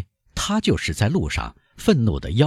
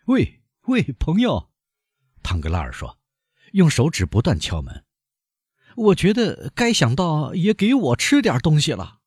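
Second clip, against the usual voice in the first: Danglars pleading; 9 semitones higher than usual. A narrator's reading.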